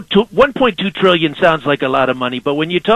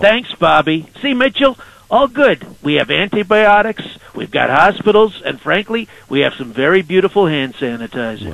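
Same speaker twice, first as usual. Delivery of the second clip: Donald Trump impression. Trump calling in to radio hosts, swaggering and boastful. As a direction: sell every line like the president on a call-in.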